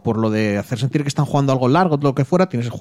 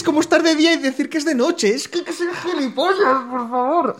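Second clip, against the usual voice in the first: laughing. Real, not acted. Laughing while ranting.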